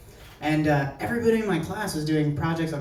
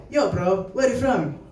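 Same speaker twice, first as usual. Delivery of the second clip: a hint of a badly faked Indian accent; attempted Indian accent; 3 semitones higher, 4 dB louder than usual. Classmate asking the same question, lightly faked Indian accent. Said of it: "I can't do a good Indian accent".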